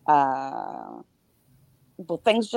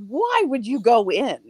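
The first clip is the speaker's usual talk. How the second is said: higher-pitched mock protest at the screen; exasperated movie-watcher; 6 semitones higher than usual. Viewer to a horror-movie character, exasperated mock protest. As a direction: call out incredulously, half playing.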